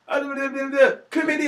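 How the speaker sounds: high, loud garbled ranting; mocking himself on stage. Comedian mimics how the angry boyfriend saw him, shrill mock ranting. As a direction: parody yourself as a shrieking heckler. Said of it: "he's basically seen me go".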